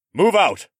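loud commanding call; ordered. A narrator's reading. Mech ordering the guards to move out, loud. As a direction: bark the order to the troop. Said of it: "Mech ordered".

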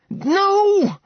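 a very high, sharp scream of no; anguished cry. Acted. Seraphina cries out as Max disappears, very high. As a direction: scream it as he vanishes.

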